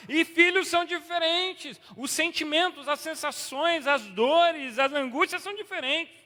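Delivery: loud, high fervent preaching. Acted. Pastor preaching loudly to the congregation, fervent. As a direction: preach it out to the church.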